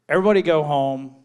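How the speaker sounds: loud, fast, exasperated call to wrap; fed-up shout. Retold or imitated. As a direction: bark it at the whole crew. Fed-up director ending the shoot.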